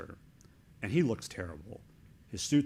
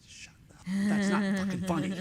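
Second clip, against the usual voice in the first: a higher, louder mock snap; irritated retort; 9 semitones higher, 4 dB louder than usual. Agent Jerry Parr snapping at the joking president, mock anger. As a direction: bark it back like an annoyed agent. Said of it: "Jerry Parr's looking at it going".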